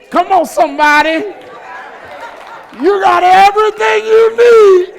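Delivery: mock indignant callout, rising to a laugh; calling her out. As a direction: catch her in the lie, loudly. Preacher calls out the widow's lie.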